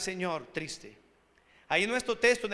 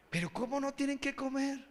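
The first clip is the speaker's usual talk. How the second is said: baffled disbelief; 3 semitones higher, 8 dB quieter than usual. Acted. Provider's baffled reply, acted.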